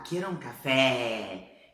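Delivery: CAFÉ drawn out with a wide Portuguese é; open vowel. Acted. Learner ordering in a cafeteria in Spain, open vowel. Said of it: "não tem som aberto".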